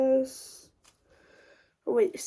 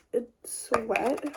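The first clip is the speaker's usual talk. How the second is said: ordinary talk, nothing marked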